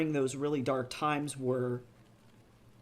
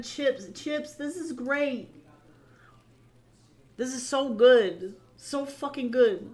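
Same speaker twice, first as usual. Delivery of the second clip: high gushing; 9 semitones higher, 4 dB louder, slower than usual. Real, not acted. Gushing over fan art.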